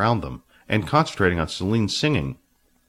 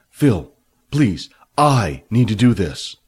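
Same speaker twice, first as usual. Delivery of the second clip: earnest, louder, slow; pleading; 5 dB louder, slower than usual. A narrator's reading. Billy begging Phil to help, earnest plea. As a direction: beg him sincerely.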